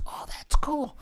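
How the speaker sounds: hushed, breathy approval of a prank; conspiratorial. Retold or imitated. Son to dad about the Kurt Russell torso, conspiratorial whisper.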